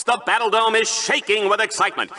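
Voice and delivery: usual speaking voice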